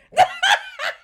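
high loud shriek of laughter. Real, not acted. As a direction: howl with laughter. Laughing to tears.

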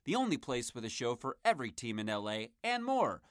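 upbeat network ad voice; punchy podcast network plug; promo announcer read. Acted. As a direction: sell it like a radio promo.

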